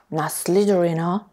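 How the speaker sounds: voiced, amused hat echoing him back; sorting hat voice. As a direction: toss his words back, curious. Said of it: "said a small voice".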